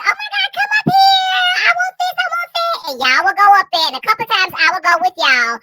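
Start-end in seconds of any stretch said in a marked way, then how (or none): 0.0-2.8 high, squealing eager call; squealing excitement. Retold or imitated. Friend imitating T calling her over, high squeal. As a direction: squeal to get her to come up. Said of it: "T was like".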